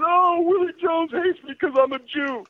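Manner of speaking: mock crying schoolgirl. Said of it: "she starts screaming and crying her head".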